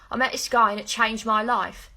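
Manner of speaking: London vowels, long and forward; bad London accent. Shown as a demonstration. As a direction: put on a rough imitation of a London accent. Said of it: "It's a bad accent".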